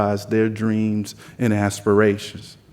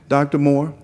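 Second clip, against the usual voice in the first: put-on thick Southern accent; 5 semitones higher than usual. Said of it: "just imagine a really thick Southern accent".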